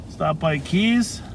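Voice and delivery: ordinary talk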